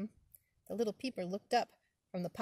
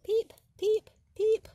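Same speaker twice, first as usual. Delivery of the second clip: high, loud piping peeps; high frog peep; 14 semitones higher, 10 dB louder, slower than usual. Acted. The spring peeper calling in the woods, high and loud. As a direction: chirp it like a tiny frog.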